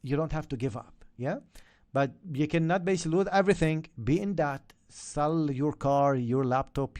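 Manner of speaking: normal talking voice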